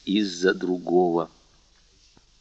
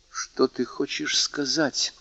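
slow, soft, guarded question; proud challenge; 4 semitones higher, 7 dB quieter, slower than usual. A narrator's reading. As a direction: hold the challenge back, quietly. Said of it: "спросил Мерседес с гордым вызовом". (тихий сдержанный вызов)

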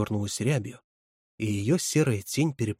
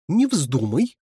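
louder, indignant "Не вздумай" with a rising "не"; 5 semitones higher, 6 dB louder, slower than usual. A narrator's reading. Counselor forbids the boy from quitting, indignant and louder. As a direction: bristle as you forbid it. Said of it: "возмутилась Лида".

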